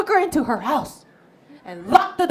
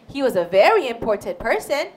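ordinary delivery

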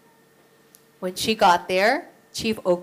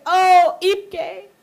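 crying; 9 semitones higher, 4 dB louder than usual. Acted. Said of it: "She would cry and say".

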